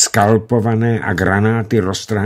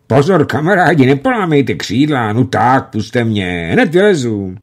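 calling out; 5 semitones higher, 5 dB louder than usual. A narrator's reading. Pilot calls to crowding airmen.

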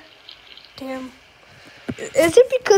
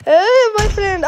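raised, mock Indian-accented greeting; 4 semitones higher, 4 dB louder than usual. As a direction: call out in a put-on Indian accent. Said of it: "I'm gonna act as Indian".

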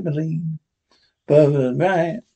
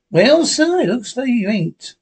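[name]' voice; 7 semitones higher than usual. Acted. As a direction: crow over the beaten boss.

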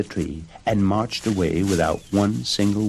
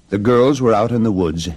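ordinary delivery, nothing marked

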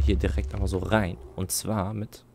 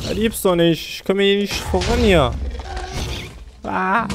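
frustrated shout; 8 semitones higher, 7 dB louder than usual. Real, not acted. Frustrated at being stuck.

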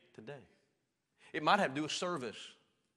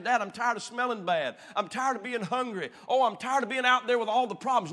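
higher, louder complaining whine; whiny self-pitying son; 9 semitones higher, 10 dB louder than usual. Acted. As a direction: whine to dad about hunger and smell. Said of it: "crying saying".

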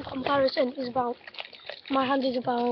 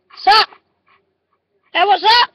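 higher, much louder hey what's up to the dog; pumping up the dog with a shout; excited greeting; 8 semitones higher, 14 dB louder, faster than usual. Real, not acted. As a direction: rile the dog up with a loud greeting.

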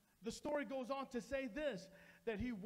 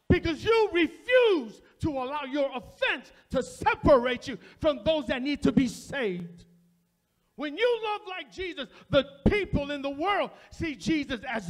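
shouted, much louder sermon crescendo; full-force preaching; 4 semitones higher, 18 dB louder than usual. Acted. Preacher urging the congregation to love like Jesus, shouted preaching. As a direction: thunder it out like a revival climax.

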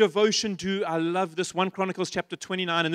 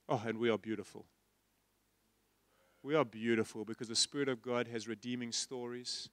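soft tender affirmation; 9 semitones lower, 10 dB quieter than usual. Real, not acted. Preacher softly affirming.

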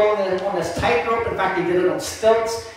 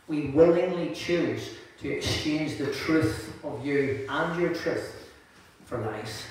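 soft, reverent pastoral prayer, softer than his preaching; quiet prayer; 5 semitones lower, 5 dB quieter than usual. Acted. Pastor praying softly over his church before the sermon. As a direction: pray softly over the congregation before the word.

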